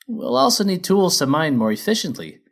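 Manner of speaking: high, loud, cheery; chipper miner mare. Acted. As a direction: bounce in like a keen foreman. Feldspar lists demands.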